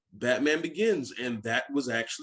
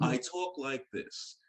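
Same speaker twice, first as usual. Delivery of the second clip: slow, softer everyday civilian voice; Bruce Wayne; 6 dB quieter, slower than usual. Retold or imitated. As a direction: imitate the hero's unmasked voice, unhurried and quiet. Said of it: "When I gotta talk as Bruce Wayne".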